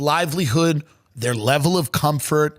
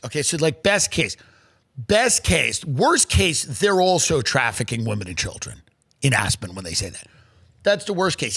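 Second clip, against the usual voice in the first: usual speaking voice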